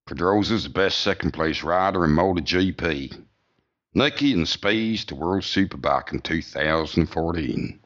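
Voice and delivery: put-on American accent; Big John Hart accent. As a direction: read out punchy bullet points in mock American. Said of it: "that was Big John's".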